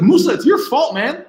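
high, loud mock telling-off; hammed-up angry rant; exasperated scolding. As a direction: scold him like a fed-up dad at breakfast. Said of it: "I was gonna be like".

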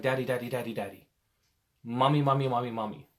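put-on insistent kid calling for attention; pestering child.

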